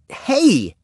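high-pitched yelp of protest. A narrator's reading. Henry grabs the clawing kittens, protesting shrilly. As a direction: cry out in sudden objection. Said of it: "protested Henry".

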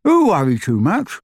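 lightly muffled through full cheeks; mouth full. A narrator's reading. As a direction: talk around a bite of food. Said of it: "with his cheeks bulging".

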